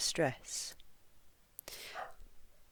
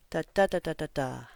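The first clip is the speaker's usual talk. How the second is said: second and last "da" loud, middle ones light and quick; rhythm in da; 2 semitones lower, 5 dB louder, much faster than usual. Shown as a demonstration. Teacher demonstrates the longest phrase's beat, stressed "da" rhythm. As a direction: squeeze the middle "da" beats, drop the last. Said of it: "say da instead of words".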